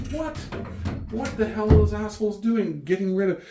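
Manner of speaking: loud, high-pitched mock outrage; hammed-up exasperation; indignant retelling. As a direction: rant in disbelief at the wine sellers. Retelling outrage at discontinued wine.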